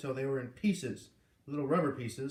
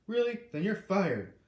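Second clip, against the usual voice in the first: angry; 7 semitones higher, 6 dB louder than usual. A narrator's reading. Boss fires foreman.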